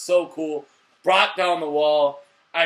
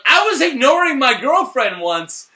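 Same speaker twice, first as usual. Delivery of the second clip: higher, louder mock Gilly line; lightly voiced Gilly, higher and louder; 8 semitones higher, 8 dB louder than usual. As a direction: toss it off as a goofy Gilly. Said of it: "And then Gilly's like".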